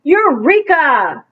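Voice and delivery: triumphant exclamation; confident eureka. Acted. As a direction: announce it like a big discovery. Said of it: "say something with confidence".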